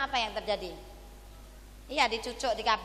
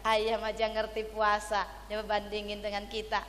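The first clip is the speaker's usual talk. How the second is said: usual speaking voice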